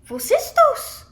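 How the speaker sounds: high-pitched, curious asking; storyteller's amazed question; wondering question. A narrator's reading. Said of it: "she asks".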